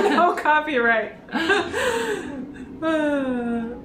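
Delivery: laughing through the words. Real, not acted. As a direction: giggle out the words. Laughing at the copyright joke.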